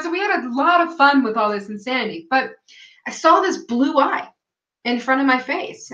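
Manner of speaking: ordinary talk, nothing marked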